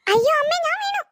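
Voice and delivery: high, sudden yelp as she slips; startled cry of falling. Acted. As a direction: cry out as you tumble into the mud. Falls into the muddy pit. (ചെളിയിൽ വീഴുമ്പോൾ പേടിച്ച് ഉയർന്ന ശബ്ദത്തിൽ നിലവിളി)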